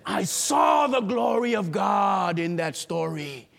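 ordinary talk

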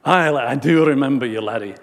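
imitated old Scotsman; Scottish accent. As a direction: put on a Scottish lilt. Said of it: "Still had his Scottish accent".

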